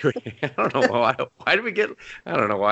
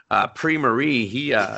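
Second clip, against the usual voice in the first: ordinary talk, nothing marked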